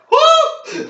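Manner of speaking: high, loud laughing whoop; excited whoop. Real, not acted. Man cracking up at his own joke, high whoop. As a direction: let out a big high "woo".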